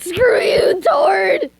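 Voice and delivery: high, loud shout of mock fury; mock angry shout. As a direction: yell it at Tord, hammed-up. Outburst at Tord after accent slip.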